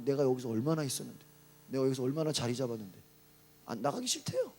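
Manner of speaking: low, mildly grumbled mock complaint; grumbling demons. Demons refusing to leave when driven out, grumbling. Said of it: "막 투덜거리면서 나가요".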